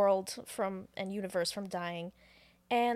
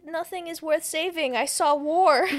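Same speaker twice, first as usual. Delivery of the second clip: high, mock-anguished outcry building louder; despairing wail; 6 semitones higher, 7 dB louder than usual. Retold Lilu despairing, wailed.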